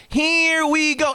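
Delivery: slow, high drawn-out announcer call; ring announcer. Retold or imitated.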